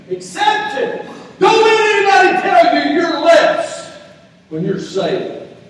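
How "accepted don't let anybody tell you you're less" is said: high, emphatic pulpit shout; full-force preaching. Real, not acted. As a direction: drive it home to the congregation, loud and high. Preaching climax to congregation.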